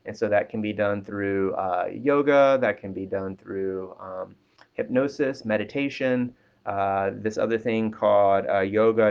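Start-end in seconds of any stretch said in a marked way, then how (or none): none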